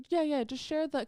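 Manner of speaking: a hint of the professor urging her on. Professor telling the student to share her screen, lightly voiced. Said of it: "He's like".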